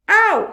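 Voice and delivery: sharp high cry of pain; loud ow. A narrator's reading. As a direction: yelp as the hail strikes. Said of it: "he cried".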